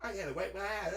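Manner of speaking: ordinary delivery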